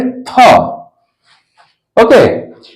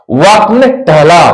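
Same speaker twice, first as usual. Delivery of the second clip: normal talking voice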